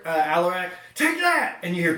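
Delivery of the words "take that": high, triumphant shout; battle cry. Acted. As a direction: shout it while casting. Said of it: "you guys hear, uh, Alarak".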